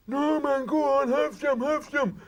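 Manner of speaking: high, coaxing insistence; pushy mate. Retold or imitated. Brian presses the joint on him, high and coaxing. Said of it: "my mate Brian goes".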